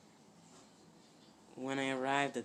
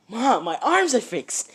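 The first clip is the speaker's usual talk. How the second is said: high, loud and slow, overjoyed; loud joyful cry of relief; excited announcement; 10 semitones higher, 11 dB louder than usual. Acted. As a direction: shout the good news to Mom. Deku shows mother healed arms.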